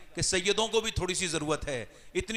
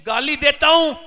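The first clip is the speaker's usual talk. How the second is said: rising anger; 6 semitones higher, 10 dB louder than usual. Retold or imitated. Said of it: "बोला".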